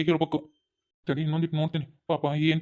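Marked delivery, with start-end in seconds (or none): none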